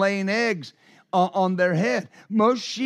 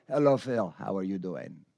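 very low and very soft, barely there; Lawrence's soft voice; 11 semitones lower, 6 dB quieter than usual. Retold or imitated. Imitating how Lawrence quietly greets Phil, very low and very soft. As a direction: imitate a gentle man's hushed greeting. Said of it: "Lawrence talks like this".

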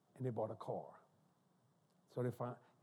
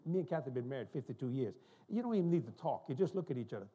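usual speaking voice

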